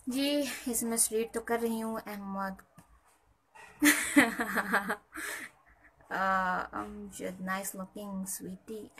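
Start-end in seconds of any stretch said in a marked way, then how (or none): 3.6-5.5 long rolling laugh; extended burst of laughter. Real, not acted. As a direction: crack up at a comment. Laughing at a chat comment.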